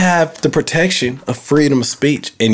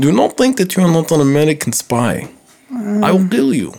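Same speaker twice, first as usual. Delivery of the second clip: put-on Russian accent, raised pitch at the end; 5 semitones higher than usual. Shown as a demonstration. Comedian showing co-host his Russian accent, mock threat. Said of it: "Could I do a Russian accent?".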